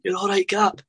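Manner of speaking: John Robertson's retold shout. John Robertson to his injured player on the ground, mock shouting. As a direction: imitate urging a player up, half-shouted. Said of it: "John Robertson shouting at me".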